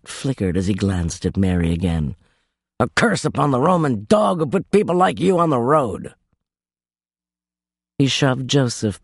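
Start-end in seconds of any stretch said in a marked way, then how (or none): 2.8-6.1 a gruff innkeeper shutting the door on them; harsh innkeeper. Acted. Innkeeper voiced in story.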